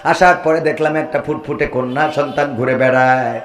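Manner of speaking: melodic sermon storytelling. A narrator's reading. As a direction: tell the tragic tale in a singsong preacher's cadence. Preacher chants a tragic tale.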